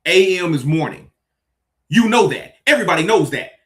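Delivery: loud and exasperated, voice rising high; ranting at someone asking what A M means, loud and exasperated. Real, not acted. Exasperated rant building.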